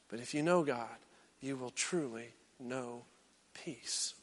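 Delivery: soft, hushed promise of peace. Real, not acted. Preacher hushes to promise the congregation peace, near whisper. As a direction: let it fall to a near whisper.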